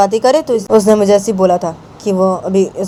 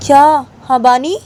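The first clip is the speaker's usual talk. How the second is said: high, indignant question; acted indignation; angry challenge; 7 semitones higher, 4 dB louder than usual. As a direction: challenge them with a pitched-up voice.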